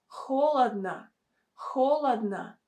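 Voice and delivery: "Холодно" said HO-lad-na. Shown as a demonstration. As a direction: say "Холодно" with stressed first O, then two A sounds. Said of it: "pronounced as a sound A".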